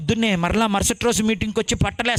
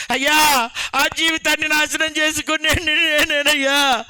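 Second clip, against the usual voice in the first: higher, slow, imitating a sobbing cry for mercy; retold crying shout; 5 semitones higher, slower than usual. The repentant drunk cries out before the crowd, imitated sobbing shout. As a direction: cry it out like a broken drunk begging. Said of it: "పెద్ద పెద్దగా అరుస్తూ, ఏడుస్తూ".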